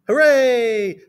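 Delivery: high, loud storybook cheer; drawn-out high hooray. A narrator's reading. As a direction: cheer it out as a delighted pupil. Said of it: "said Emily Elizabeth".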